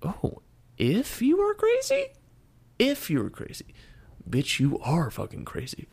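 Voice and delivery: very high, incredulous echo; mock disbelief. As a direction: parrot it back in high-pitched disbelief. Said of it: "thinking inside my head, like".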